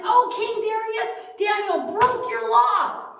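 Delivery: high, loud tattletale voice, slowing at the end; tattling schemers. Acted. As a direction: tell on Daniel, high and loud.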